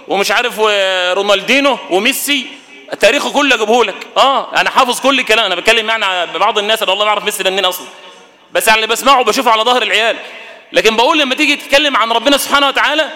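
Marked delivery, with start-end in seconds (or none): none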